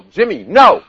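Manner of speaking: loud, high urgent shout; acted alarmed parent's yell; yelled warning. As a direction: yell to stop a toddler at the road. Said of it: "and they yell".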